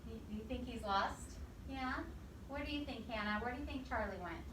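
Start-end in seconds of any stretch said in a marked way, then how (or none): none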